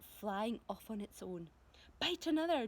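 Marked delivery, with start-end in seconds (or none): 2.0-2.7 urgent command to the centipede. Acted. James ordering the centipede, high and loud. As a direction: bark the order higher and louder. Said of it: "James ordered".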